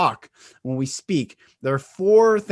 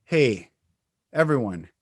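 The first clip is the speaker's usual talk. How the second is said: slow greeting; 4 semitones lower than usual. Shown as a demonstration.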